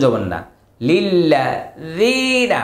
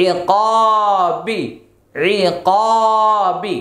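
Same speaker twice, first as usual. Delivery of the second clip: drawn-out syllables; 4 semitones higher, much slower than usual. Shown as a demonstration. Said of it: "spelling lesson, joined word chanted".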